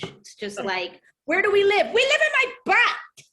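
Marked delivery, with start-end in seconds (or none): 1.2-1.9 high, loud playful parent prompt; mom quizzing toddler. Retold or imitated. Mother acting out quizzing her small son, high and playful. 1.9-3.2 put-on very high, loud kid voice; imitated little boy. Mother imitating her three-year-old's answer, high and loud.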